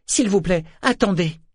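put-on high, urgent girl's pleading; anguished. A narrator's reading. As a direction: plead anxiously for them to wait, pitched well up. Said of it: "L'angoisse inhabituelle qui vibrait dans la voix".

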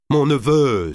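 Mon neveu cried out high and loud; an uncle rushing forward to stop his nephew, crying out; exclaiming. A narrator's reading. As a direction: burst out in protest. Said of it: "s'écria-t-il en s'élançant".

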